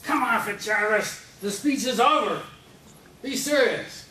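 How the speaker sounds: high, loud mock yell of exasperation; hammed-up heckler shouting; mock exasperated shout. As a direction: holler at him to quit clowning. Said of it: "somebody might say to me".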